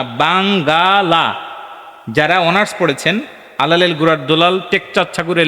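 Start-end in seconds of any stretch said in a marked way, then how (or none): 0.0-1.3 বাঙ্গালা slow, all three syllables ba-ng-ga-la; old form Bangala. Shown as a demonstration. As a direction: stretch বাঙ্গালা, not বাংলা. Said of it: "বাংলা শব্দ না". (বাঙ্গালা ধীরে, তিন মাত্রায়)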